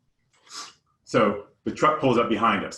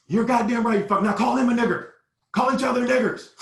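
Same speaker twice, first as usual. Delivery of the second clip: high, loud hateful yelling; furious imitated yell, much higher and louder; going off on us; 11 semitones higher, 4 dB louder than usual. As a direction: scream the order at the boys. Gunman ordering boys.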